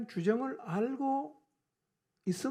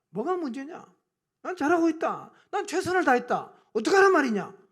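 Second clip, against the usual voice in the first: loud, raised self-justifying retorts; defiant denial; 5 semitones higher, 7 dB louder than usual. Acted. Backslider who never admits fault, in defiant denial. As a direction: refuse all blame, louder with each retort. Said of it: "절대로 자기 모습 인정 안 합니다".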